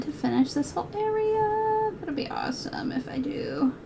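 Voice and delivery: soft unvoiced murmur; hope under your breath; hushed self-talk; whispered to herself. Real, not acted. Muttering to herself while sewing.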